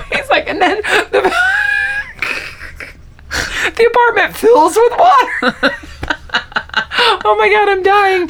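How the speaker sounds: high, breathless laughing retell; laughing through words. Real, not acted. Friend retelling the apartment flood scene, laughing hard. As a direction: try to talk through helpless laughter.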